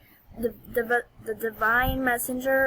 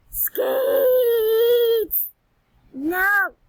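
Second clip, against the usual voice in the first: much higher, louder cracking wail; anguished shout; 9 semitones higher, 6 dB louder than usual. Real, not acted. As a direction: scream it in real frustration. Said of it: "Ow, my voice hurts".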